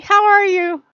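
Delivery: high, loud cat asking how are you; needy kitten, quick and bright; attention-seeking cat voice. Pretend. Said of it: "being like".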